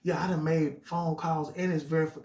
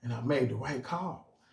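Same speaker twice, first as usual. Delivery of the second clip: ordinary delivery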